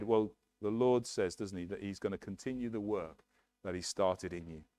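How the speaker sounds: ordinary talk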